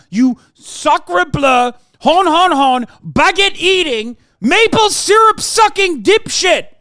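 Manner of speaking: screaming, high, slow mock French-Canadian accent; hammed-up French accent, yelled high and dragged out. Mocking Canadians with French clichés.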